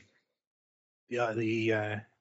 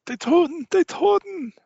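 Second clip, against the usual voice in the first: high, loud desperate babble; frantic German pleading; 6 dB louder than usual. Acted. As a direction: rave in terror about the dead. GM voices German officer again.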